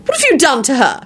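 high accusing question; demanding. A narrator's reading. Sister-in-law confronting husband over empty seat, demanding. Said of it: "she demanded".

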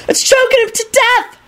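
very high, panicked cry; high-pitched horrified squeal; terrified scream. A narrator's reading. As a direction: scream it like a terrified first year. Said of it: "a first year screamed".

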